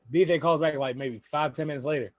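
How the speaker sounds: usual speaking voice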